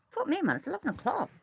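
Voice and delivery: high, fast, startled; shocked at the time; surprised. Real, not acted. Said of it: "What time is it?".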